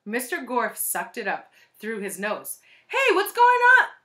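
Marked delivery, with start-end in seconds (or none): 2.9-3.9 very high, loud shout; loud, high-pitched holler; calling out. A narrator's reading. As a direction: yell it out like a rowdy pupil. Pupil calls out in class.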